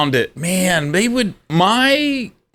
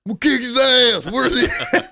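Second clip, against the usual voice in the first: mock angry yell; 10 semitones higher than usual. Angry boyfriend voiced, high loud.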